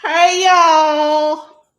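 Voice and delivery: camp it up as a whiny party-loving congresswoman; Marjorie Taylor Greene. Acted. Comic doing a political impression for viewers, high mock whine. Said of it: "This is my Marjorie Taylor Greene impersonation".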